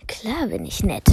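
geflüstert, hörbar grinsend; hinterhältig grinsend flüstern; grinsendes Flüstern. Acted. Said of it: "flüsterte der Wolf grinsend". (der Wolf flüstert grinsend, leise)

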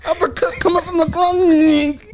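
hype shout. Acted. Joking about uppercut.

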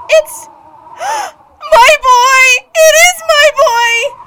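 high, loud sobbing cry of joy; tearful joyful shout. Acted. Mother seeing her son return, sobbing shout. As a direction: cry it out, overwhelmed with relief.

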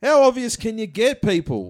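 loud mock-outraged call to everyone; exclaiming loudly. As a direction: throw it out at the crowd, indignant.

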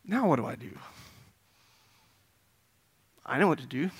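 soft acted uncertainty with long pauses; subdued self-questioning. Believer at the front end of a trial, quietly wondering. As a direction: think it through quietly, as if to yourself.

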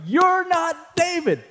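high, slow, drawn-out retold yell; imitated yell. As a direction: lean in and yell it slowly at the congregation. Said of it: "and he yells".

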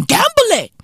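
gamble it yelped way up high; high urging. Acted. Comic urging viewers to take risks, high squeal.

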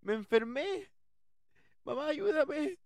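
high, loud mock-panicked cries for help; frightened screaming. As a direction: scream for mom in hammed-up terror. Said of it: "Me empiezo a gritar".

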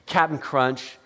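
ordinary delivery

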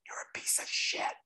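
a hushed, breathless whisper of the inner critic; barely voiced, a cruel secret in your ear. Recovering addict voicing the nasty voice in his head, whispered. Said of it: "a little voice in your head that goes".

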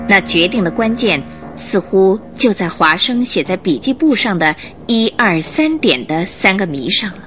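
normal talking voice

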